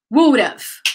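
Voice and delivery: Would've said as one word, wud-uv; linked would've. Shown as a demonstration. Teacher modelling the linked sound for repetition. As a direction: blend would have into one quick sound. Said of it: "Guys, repeat".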